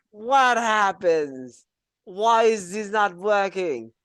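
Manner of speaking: baffled, drawn-out questions; confused student. Acted. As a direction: play the lost student who can't make it work.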